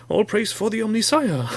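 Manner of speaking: high, proclaiming cultist; mock zealot. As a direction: hail the machine god. Mock worship of machine overlords.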